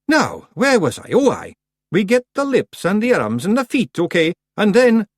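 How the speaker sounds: high, loud Scots wee-man voice; high Scots pictsie. Acted. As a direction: lecture the clan like a cocky wee chief. Rob resumes.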